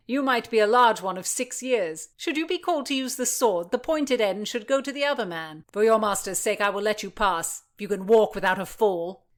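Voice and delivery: a high, loud mocking guard; swaggering guard. Guard Blackjack mocks the small page, loud and high. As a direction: tease the page from his post.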